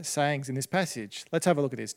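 usual speaking voice

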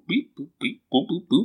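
high beeping noises. Acted. Imitating texting sounds.

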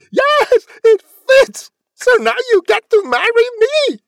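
very high, very loud shriek; triumphant scream. A narrator's reading. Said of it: "But she screamed".

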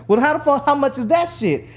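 high, loud urgent question; a worked-up friend who wants in; excited demand. Retold or imitated. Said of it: "and he was like".

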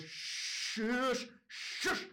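high, hissed "shush". Retold or imitated. Shaking dad trying to silence the judge, strained shushing. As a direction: shush her in two tense bursts. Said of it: "he just starts shushing".

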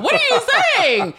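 high, loud, laughing yell; mock outburst. Host reacts to guest's quiz answer, mock outburst. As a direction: cry out in playful exasperation.

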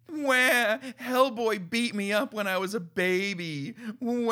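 a hammed-up blubbering demon mocked by a critic; mock whining crybaby. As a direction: whine it like a bratty crybaby monster. Mocking the Pig Demon as whiny.

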